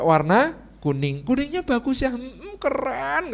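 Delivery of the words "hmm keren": drawn-out 'keren' of admiration; husband's approval. Acted. Husband praises wife's yellow outfit, drawn-out approval. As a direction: stretch it out slowly, approving. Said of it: "kata suaminya".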